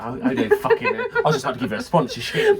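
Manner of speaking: squeaky high mocking impression with laughter. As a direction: whine it out high and giggling. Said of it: "He's like".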